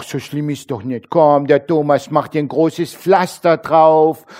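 put-on cooing, coddling voice to a hurt child; a hint of soothing a toddler; mock baby talk. Coddling someone who fell. (spöttisches Babygeplapper)

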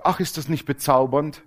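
normal talking voice

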